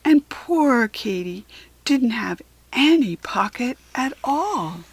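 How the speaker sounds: plain reading voice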